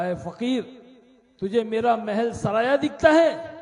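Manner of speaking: the king snaps at the beggar; king scolds the beggar in mild anger; angry king. Acted. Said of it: "غصہ آیا".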